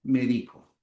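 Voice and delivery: "Medico" with the stress on DI; stress on middle syllable. Shown as a demonstration. Teacher repeating the verb form, "Medico" stressed on DI. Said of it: "Cambia el acento y cambia el significado".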